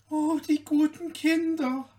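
put-on touched to the heart by the children; moved exclamation. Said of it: "rief Märchen bewegt aus".

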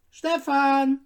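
put-on a mother calling her child inside. The mother calls Stefan in from the door, a loud high call. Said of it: "rief die Mutter unter der Türe".

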